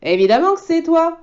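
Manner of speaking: all three insist at once; bears in chorus. Acted. Three bears answer together, loud and high. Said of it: "d'une seule voix".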